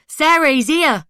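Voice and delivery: high, loud, slow call; shouting. A narrator's reading. Laura shouting to her sister that he's arrived, loud call. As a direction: yell the news down the street. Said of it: "she was shouting".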